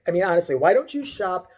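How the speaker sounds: normal talking voice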